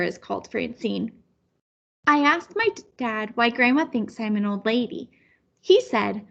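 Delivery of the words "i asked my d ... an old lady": plain reading voice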